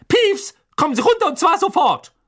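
high, loud scolding command; angry shout. A narrator's reading. Professor orders the poltergeist down at once, angry high shout. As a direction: order a troublemaker down, furious. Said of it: "rief eine zornige Stimme".

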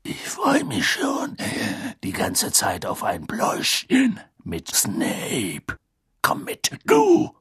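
drawn-out growly character voice; gruff Moody, menacing relish. Acted. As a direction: savour it slowly, then bark the order.

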